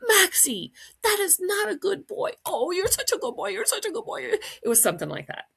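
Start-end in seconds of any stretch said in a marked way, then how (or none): 0.1-2.3 high, loud pet-owner telling-off; mock scolding. Owner to runaway dog just returned, mock scolding. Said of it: "this sort of reaction when I saw him". 2.5-4.2 put-on very high doting baby talk to a dog; gushing praise. Relieved owner to returned dog, gushing praise. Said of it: "completely conflicting".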